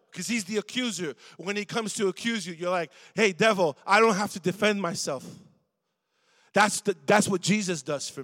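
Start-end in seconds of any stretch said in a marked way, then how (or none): 3.1-5.2 louder, bold defiant declaration; defiant to the devil. Acted. Preacher voicing a believer rebuking the devil, loud defiance. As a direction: stand up and tell the devil off.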